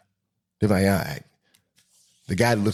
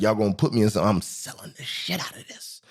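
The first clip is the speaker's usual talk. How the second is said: put-on smug kid voice. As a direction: whisper a smug brag like a child actor. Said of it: "And he was like".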